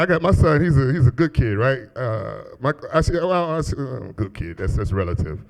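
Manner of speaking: ordinary delivery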